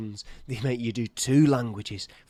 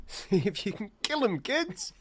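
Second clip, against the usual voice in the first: laughing echo; 8 semitones higher than usual. Pretend. Echoing the line laughing.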